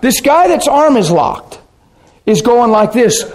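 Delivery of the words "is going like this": ordinary talk, nothing marked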